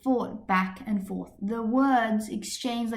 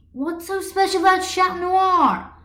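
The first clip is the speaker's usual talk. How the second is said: 1 challenging; 10 semitones higher, 8 dB louder than usual. A narrator's reading. Adrian provoking Marinette in debate.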